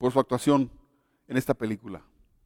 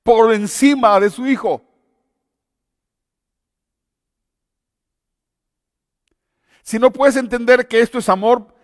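raised, impassioned preacher's call; preaching at full force; 4 semitones higher, 3 dB louder than usual. Acted. Preacher pressing the congregation to understand God's love, fervent. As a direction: drive home the love of God with fervor.

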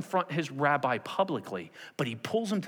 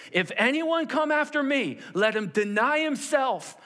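high, loud, driving declaration; urgent, raised-voice call to follow; impassioned preaching; 6 semitones higher, 4 dB louder than usual. Acted. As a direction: proclaim the challenge at full force. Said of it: "But he says this".

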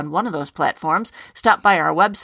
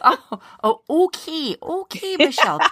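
mock Southern accent; 8 semitones higher than usual. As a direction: tease with a put-on Southern lilt. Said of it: "try to do one of your Southern accents".

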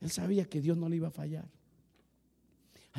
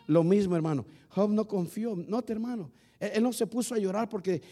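usual speaking voice

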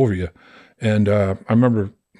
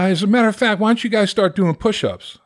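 higher sarcastic coach voice; mock coach challenge; 10 semitones higher than usual. Coach sarcastically ordering push-ups, high mocking voice. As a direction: toss out the order like a taunt.

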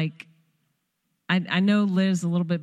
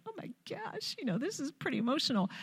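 high, very soft, overwhelmed squeak; mock choked-up; 8 semitones higher, 12 dB quieter, faster than usual. Mother retelling her daughter's wedding, mock choked-up voice. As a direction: imitate being overcome, thin and hushed.

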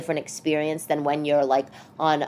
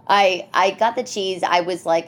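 ordinary talk

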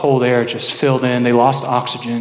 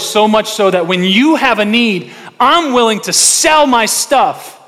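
high, loud, fervent sermon delivery; preaching at full force; 8 semitones higher, 5 dB louder than usual. Acted. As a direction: preach it out to the congregation.

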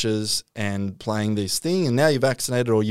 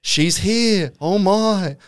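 higher-pitched mock awe; lightly voiced believer, pitched up; awed reporter; 6 semitones higher, much slower than usual. Reporters witness the spirit arriving at a seance, mock awe. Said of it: "one of the reporters there".

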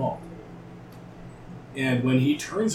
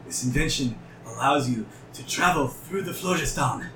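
ordinary talk

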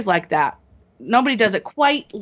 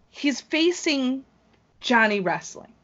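slow, higher announcer-style reveal of the opponent; dramatic announcer build-up; 5 semitones higher than usual. Acted. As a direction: draw it out like a ring announcer hyping the match. Dramatic build to the big matchup.

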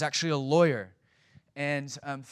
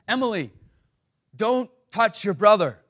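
loud, higher stern dad yelling back; 6 semitones higher, 9 dB louder than usual. Retold or imitated. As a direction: bark it like a fed-up father. Dad scolds kids from front seat.

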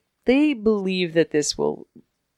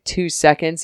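ordinary delivery

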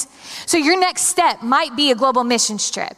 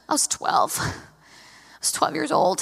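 sheepish answer; 4 semitones higher, 5 dB quieter, slower than usual. Retold or imitated. Small voice answering dad's question.

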